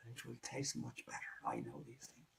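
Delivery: low, hushed whisper; sly rooster whispering his promise; whispered coaxing. Acted. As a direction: whisper it like a secret to win him over.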